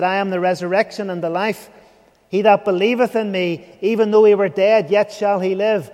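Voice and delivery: loud, ringing scripture proclamation; preaching. Acted. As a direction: proclaim it to the whole hall. Preaching quotes scripture loudly.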